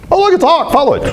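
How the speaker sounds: high-pitched, excited exclamation; excited hawk spotting. Retold or imitated.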